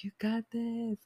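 put-on tiny, very high, soft baby voice; baby version. Baby Lightning McQueen cheering her on, baby voice. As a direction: squeak it softly like a little one. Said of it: "it was his baby version".

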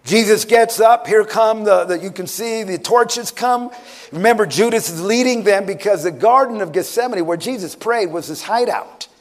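urgent, driving sermon delivery; impassioned preaching. Acted. Preacher telling church of the arrest in Gethsemane, impassioned. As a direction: build the story with rising intensity.

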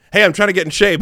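loud, high-pitched imitation of an abrupt pivot; mock eager outburst. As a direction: burst in suddenly, hammed-up and loud. Mock sudden pivot in retold chat.

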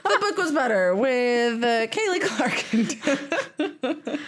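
laughing through the show intro; high, giggly and drawn out at the end. Real, not acted. Co-host finishing a flubbed podcast intro, laughing.

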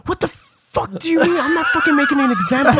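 high yelling outburst, laughing into it; furious shout. Retold or imitated. To the taxi driver who turned left: yelling, high. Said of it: "And I, I'm like".

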